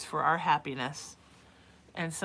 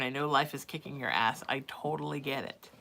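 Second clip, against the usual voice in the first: ordinary talk, nothing marked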